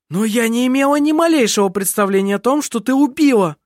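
high, loud, horrified denial; agitated mother's objection; protesting. A narrator's reading. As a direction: protest your innocence vehemently. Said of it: "запротестовала мать".

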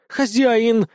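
high, loud outraged "master!"; indignant cry. Acted. Wolf objects as the dragon snuggles in, outraged cry. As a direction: call him out in outrage. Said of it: "негодовала волчица".

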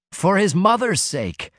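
high, drawn-out appeal; urging. A narrator's reading. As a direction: urge him on slowly, pitched high. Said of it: "Brissenden urged".

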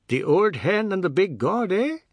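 high, loud mocking retort; jeering. A narrator's reading. Seth laughing off the threat from the veranda, jeering. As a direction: throw it back as a taunt.

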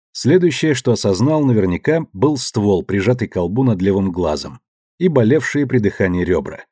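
ordinary delivery, nothing marked

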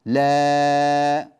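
"لا" held long and loud on the alif; long drawn la. Shown as a demonstration. Teacher showing students the long alif sound in "لا". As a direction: stretch "لا" out slowly. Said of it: "فصار الصوت".

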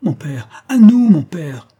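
full, high shout to father; loud urgent cry, coming down at the end; shouted call for help. A narrator's reading. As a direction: yell for rescue across the water. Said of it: "reprit Charles".

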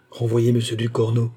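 usual speaking voice

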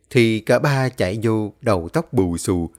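ordinary delivery

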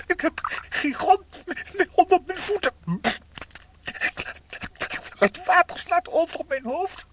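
high, loud panicked wail; frantic high-pitched distress; desperate cry. Acted. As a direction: cry out as if going under. Drowning, crying out in panic.

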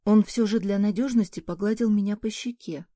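ordinary delivery, nothing marked

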